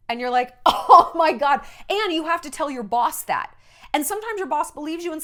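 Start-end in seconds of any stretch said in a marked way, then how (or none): 0.6-1.6 loud, higher mock exasperation; exasperated outburst. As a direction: let out a big fed-up groan of words. Acted exasperation at being turned away.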